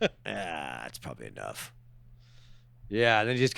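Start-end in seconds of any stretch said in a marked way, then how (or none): 0.0-1.7 much lower, quieter comic drop; casual afterthought. Acted. Gunman deciding he has shot enough, low offhand voice. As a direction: drop low and soft, as if satisfied.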